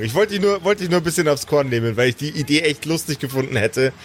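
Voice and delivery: ordinary talk, nothing marked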